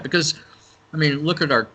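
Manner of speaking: talking around chewing gum; chewing gum while talking; mouth full. Real, not acted. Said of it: "I got gum in my mouth".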